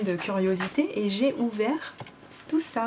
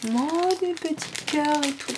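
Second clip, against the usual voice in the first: cutesy coo; 6 semitones higher, slower than usual. Real, not acted. Cooing over tiny heart charms.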